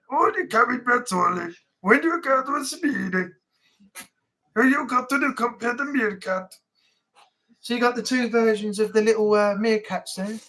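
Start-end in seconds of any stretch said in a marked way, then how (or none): 0.1-6.5 mock Romanian accent for the other meerkat; comic meerkat voice with a put-on Romanian accent; second meerkat. Shown as a demonstration. The other little meerkat plugging the website, mock Romanian accent. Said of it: "break down the other one".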